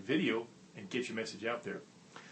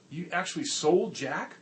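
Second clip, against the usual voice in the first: higher, slow, stressing actually; stunned disbelief; 6 semitones higher, 4 dB louder than usual. Retold or imitated. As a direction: boss still reeling. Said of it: "was stupefied".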